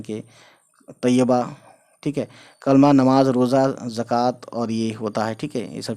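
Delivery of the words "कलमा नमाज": ordinary delivery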